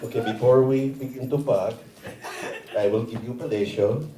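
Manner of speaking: put-on comic Filipino-accented English. Imagined Filipino woman talking to her cousin in bed, Filipino accent. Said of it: "with a Filipino woman".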